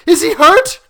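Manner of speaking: very high, loud, frantic; wild demand. A narrator's reading. Mother demanding news of her son, wild and frantic. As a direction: cry it out in terror. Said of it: "demanded the mother wildly".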